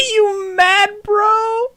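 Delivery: high squeal through helpless laughter; laughing. Real, not acted. Player repeating Lind's taunt, cracking up laughing. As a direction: cackle the taunt out, barely holding together.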